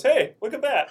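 high, loud shout to companions; mock excited callout. First guy spots a stegosaurus, mock excited callout. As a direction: call out the sighting, hammed up.

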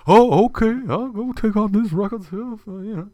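put-on old janitor voice. Fess answers his boss in a high meek voice. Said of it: "the owner ... says".

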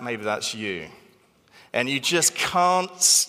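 ordinary delivery

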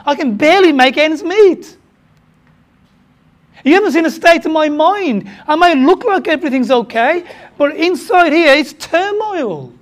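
loud, high, anguished complaint; anguished churchgoer protesting; pleading churchgoer. Acted. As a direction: voice the hurting member, pushing back. Voicing a struggling churchgoer.

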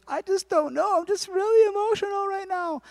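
high-pitched whimpering wife voice; mock crying. Wife upset to her new husband, mock crying. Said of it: "She was crying".

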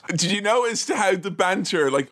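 ordinary delivery

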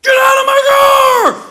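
high, loud yelling shout; furious shout. Retold or imitated. To the car thief, high furious shout. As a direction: roar it at the thief. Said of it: "like that".

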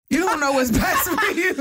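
high, laughing as she says it. Retold or imitated. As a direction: laugh through the line. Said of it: "I tell all my clients".

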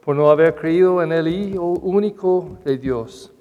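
measured gospel proclamation; solemn scripture reading; liturgical reading. Acted. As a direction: read it like the Gospel at Mass. Gospel reading then homily.

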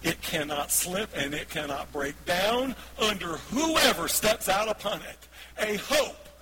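slow, loud proclamation with weighty pauses; forceful scripture reading. Real, not acted. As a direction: declare the verse phrase by phrase, building.

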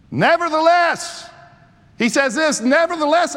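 preacher lifting "Nevertheless" for the congregation; proclaimed keyword. Acted. Preacher proclaims key word.

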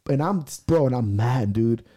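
normal talking voice

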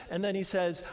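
usual speaking voice

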